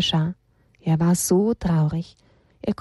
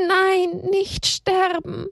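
high, slow tearful pleading; sobbing plea; 11 semitones higher, much slower than usual. Acted. Haberle sobbing at the cross, high, slow pleading. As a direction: beg through sobs, slowly. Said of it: "schluchzte Haberle immer wieder".

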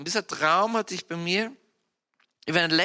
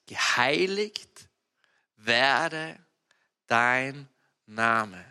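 solemn prayer; 5 semitones lower, much slower than usual. Acted.

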